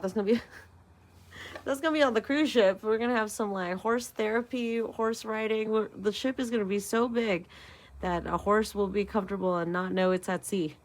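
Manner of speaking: ordinary delivery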